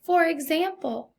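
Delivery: usual speaking voice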